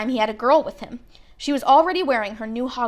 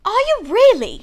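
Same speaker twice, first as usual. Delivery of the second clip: high, loud astonished gasp; be thrilled to meet him; amazed; 11 semitones higher, 7 dB louder than usual. Acted. Girl astonished to meet Harry, high and loud.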